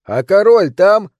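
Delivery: high, loud, drawn-out question; shouting. A narrator's reading. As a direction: call up to the wall. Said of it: "не переставая кричать". (протяжно кричит наверх)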